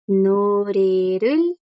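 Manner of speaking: "Norerul" drawn out very slowly, rising at the end; teacher models "Norerul" slowly for students; slow Korean demo. Shown as a demonstration. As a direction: sound out "Norerul" syllable by syllable. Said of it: "Yo puedo usar la palabra canción".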